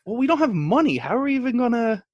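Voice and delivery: mock disbelief. Telling the roommate the plan won't work, mock disbelief. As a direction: play the doubter, pitch up in disbelief. Retelling own doubtful reply.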